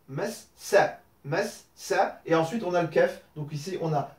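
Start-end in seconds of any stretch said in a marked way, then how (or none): 0.1-2.2 mas sa broken apart at the doubled s; split syllables. Shown as a demonstration. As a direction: separate mas from sa clearly. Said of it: "et derrière on lâche le son".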